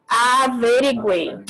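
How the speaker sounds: "Averiguei" said slowly, every sound clear; slow clear word. Shown as a demonstration. Teacher models the example word slowly for pupils. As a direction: stretch out "Averiguei" for the class. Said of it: "Averiguei é uma palavra".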